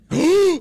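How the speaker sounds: sudden loud gasp; sharp excited gasp. Real, not acted. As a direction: gasp at the news. Twitter notification, Lakers trade.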